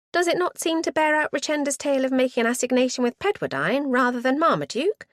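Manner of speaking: put-on high, bright eager girl voice; eager young niece. A narrator's reading. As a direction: play the keen niece, rushing. Excited niece, fists wriggling.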